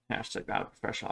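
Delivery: breathy whisper, fast, tossed off; whispered aside. Acted. Cook mocks himself to viewers, a quick whisper. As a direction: mutter the catchphrase to yourself. Catchphrase repeated under breath.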